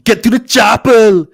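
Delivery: loud, high, slow Austrian action-hero shout; hammed-up strongman yell; Arnold Schwarzenegger. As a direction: bellow it like a movie commando. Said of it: "laughing at me as Arnie".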